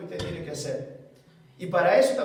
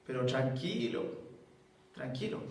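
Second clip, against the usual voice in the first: soft, lower soothing voice; calm him tenderly; gentle reassurance; 10 semitones lower, 9 dB quieter than usual. Retold or imitated. The Lord comforting the weeping pastor, soft.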